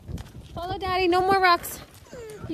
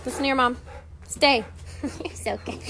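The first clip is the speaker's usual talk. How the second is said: usual speaking voice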